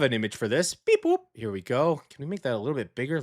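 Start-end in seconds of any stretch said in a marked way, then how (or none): 0.7-1.2 high chirpy robot beep; squeaky machine noise; beep boop sound. Pretend. Sound effect while showing image.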